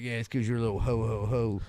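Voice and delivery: a quick hint of Santa laughing; mock Santa voice. Suggesting a comeback to his friend, a light mock Santa laugh. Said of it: "You should be like".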